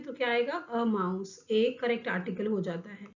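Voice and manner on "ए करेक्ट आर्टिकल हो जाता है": ordinary talk, nothing marked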